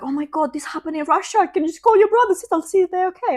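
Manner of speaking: high, pleading panicked mother, retold; desperate mom. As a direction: voice a worried mom begging on the phone. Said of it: "like, desperate calling".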